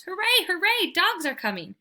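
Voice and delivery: high, bouncy burst of joy; overjoyed dog cheering; excited. A narrator's reading. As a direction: cheer like a dog who just heard friends. Dog hears other dogs arrive.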